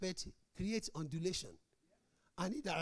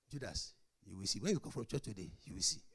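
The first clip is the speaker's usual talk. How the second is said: low muttered mock threat; 9 semitones lower, 5 dB quieter than usual. Couple threatening each other.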